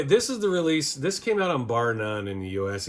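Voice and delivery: ordinary delivery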